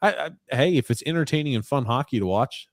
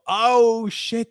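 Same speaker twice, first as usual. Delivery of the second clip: sudden high "oh shit"; surprised exclamation; 12 semitones higher, 4 dB louder than usual. Real, not acted. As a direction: blurt it out in surprise. Sudden reaction to draft pick.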